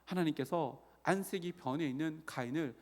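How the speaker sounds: ordinary talk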